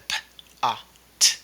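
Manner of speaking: POT broken into separate sounds p-, ah, t; sounded out. Shown as a demonstration. Teacher showing kids how to spell pot, sounded out. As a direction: say p-, ah, t one sound at a time. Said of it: "just do it from sounds".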